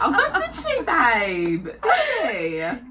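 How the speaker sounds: very high mock-shocked squeal, laughing. [name] playfully shocked at the nephew, high squeal. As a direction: squeal it in fake outrage. Mock-offended reply to child.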